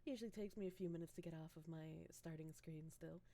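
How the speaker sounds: groggy just-woke-up voice; soft sleepy morning voice. Real, not acted. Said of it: "too loud for my morning voice".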